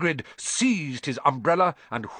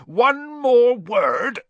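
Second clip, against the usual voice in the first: higher, louder and very slow, each word a threat; menacing threat; 11 semitones higher, 8 dB louder, much slower than usual. Acted. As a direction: drag out the final warning like a raised sword. Hagrid threatens Vernon.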